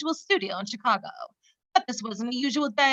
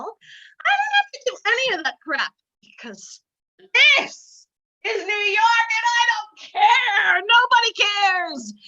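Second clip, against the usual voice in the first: excited outburst; 8 semitones higher, 4 dB louder, slower than usual. Real, not acted. Host's outburst about freedom in New York.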